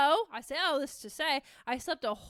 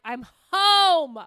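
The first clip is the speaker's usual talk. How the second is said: loud, higher exultant cry; triumphant shout; 4 semitones higher, 10 dB louder than usual. Acted. Declaring herself at home in suffering, triumphant shout. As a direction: belt it out like an arrival.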